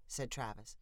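ordinary delivery

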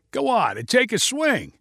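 high, goading dare; taunting. Acted. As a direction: needle him into swinging. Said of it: "said Kevin in a taunting voice".

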